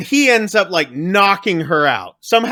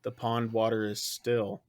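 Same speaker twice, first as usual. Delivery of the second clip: low and hushed, much softer, trancelike; eerie possessed voice; 7 semitones lower, 13 dB quieter than usual. Retold or imitated. Possessed Sean in the bathtub, low hushed eerie monotone. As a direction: murmur it low like someone possessed. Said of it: "he's sitting there and he's like".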